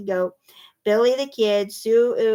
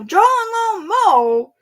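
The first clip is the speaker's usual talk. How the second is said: high, slow sing-song mole voices; chirpy moles; 11 semitones higher, 8 dB louder, slower than usual. A narrator's reading. The moles introducing themselves to the animals, high and sing-song. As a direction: announce the names in a sing-song.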